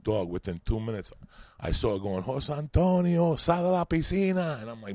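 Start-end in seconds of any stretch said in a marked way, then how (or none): 2.2-4.6 slow, high, loud call; angry mother calling. Retold or imitated. Mother ordering her son out of the pool, loud and high. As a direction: drag out the name to summon him.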